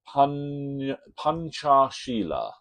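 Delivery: slow, careful attempt at Panchashila; careful pronunciation. Real, not acted. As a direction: take care over each syllable of Panchashila, drawn out. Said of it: "trying to get my pronunciation right".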